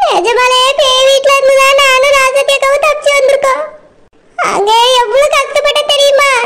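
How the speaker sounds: a pleading wife's voice, put on. Wife pleads with disbelieving husband, put-on voice. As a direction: plead your case to a doubting husband.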